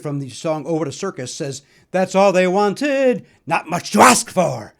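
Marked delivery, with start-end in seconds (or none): 1.9-4.7 slow, louder, lightly imitated theatrical line; dramatic line reading. Lightly imitating the actor's line from the old commercial, slow and dramatic. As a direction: draw the line out slowly like on stage. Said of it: "he does it in such a way".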